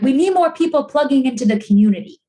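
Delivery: a hint of a New England accent, playful; Gina McCarthy New England accent. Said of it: "She's, like, so New England, has, like, the best accent ever".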